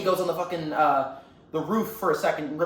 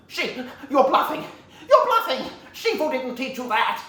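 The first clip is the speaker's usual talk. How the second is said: high, loud, halting villain voice; hammed-up nervous bluster; acted panicked denial; 7 semitones higher, 5 dB louder, slower than usual. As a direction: insist with mounting alarm. Villain's alarmed denial in acted scene.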